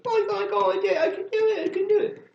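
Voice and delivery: very high mock-excited squeal; fake gushing. Host begging to be lied to, fake gushing. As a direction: gush a flattering lie in falsetto. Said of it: "lie to me and tell me".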